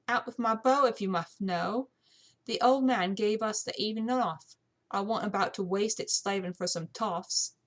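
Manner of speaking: put-on saucy maid with a British accent; defiant young housemaid; cheeky British housemaid. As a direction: answer back like an insolent servant.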